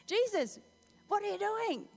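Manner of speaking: loud, high indignant scolding; rebuking. Acted. Peter takes Jesus aside to correct him, rebuking. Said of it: "he began to rebuke him".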